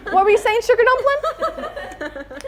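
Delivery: high, cooing sweetness; sugary sweet mom. Retold or imitated. Mom turning back to her daughter, sugary sweet. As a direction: coo it, slowing on the pet name.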